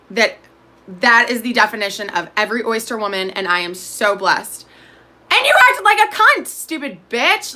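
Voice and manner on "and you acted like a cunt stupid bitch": angry insult. Acted. Angry rant at a famous relative.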